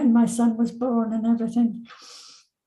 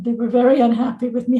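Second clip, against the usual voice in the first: usual speaking voice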